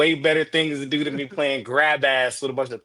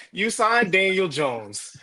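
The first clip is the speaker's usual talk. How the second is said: normal talking voice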